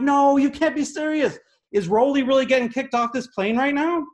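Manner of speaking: panicked disbelief. Retold or imitated. Watching attendant pull Rolly out, high alarmed disbelief. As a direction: squeal in horror as the suitcase is pulled out. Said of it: "And I'm like".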